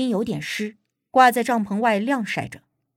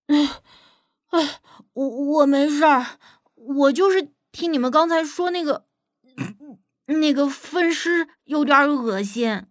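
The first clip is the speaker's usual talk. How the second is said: slow, strained higher voice with halting starts; queasy after vomiting; 3 semitones higher than usual. Acted. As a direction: struggle to talk while fighting down nausea. Youth speaks after vomiting, nauseated.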